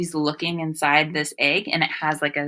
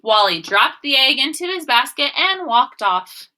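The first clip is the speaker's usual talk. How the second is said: normal talking voice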